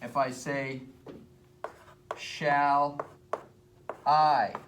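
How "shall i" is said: "Shall" then a louder, held "I"; stress on I. Shown as a demonstration. Teacher shows students an iamb, stress on "I". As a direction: split "Shall I", landing hard on "I". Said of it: "That's a da-da".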